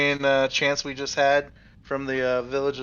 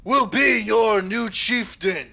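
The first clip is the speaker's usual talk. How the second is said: proclaiming bard; 5 semitones higher than usual. Acted. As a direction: announce the champion grandly. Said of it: "I tell him".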